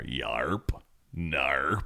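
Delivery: low drawled yarp and narp; West Country yokel. Retold or imitated. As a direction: mimic the village yokel. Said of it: "There's a character in there".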